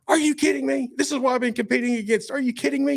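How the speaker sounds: reenacted high-pitched incredulous yelling; screaming disbelief. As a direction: yell it out high, as if stunned by a win. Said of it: "I'm screaming at the technician".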